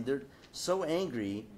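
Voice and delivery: ordinary talk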